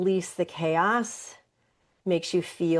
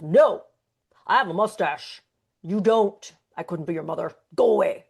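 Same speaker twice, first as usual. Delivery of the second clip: louder, gruff walrus brush-off; grumpy walrus; 5 dB louder than usual. Acted. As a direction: grumble him away as a cranky walrus. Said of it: "I made the walrus grumpy".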